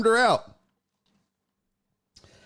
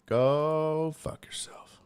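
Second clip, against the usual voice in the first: soft, slow hissed curse; whispered curse; 2 semitones lower, 4 dB quieter, slower than usual. Real, not acted. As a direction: whisper the curse under your breath. Cursing at software quietly.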